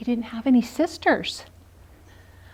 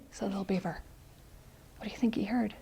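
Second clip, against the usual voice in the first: whisper; 3 semitones lower, 16 dB quieter, faster than usual. Acted. Whispered aside.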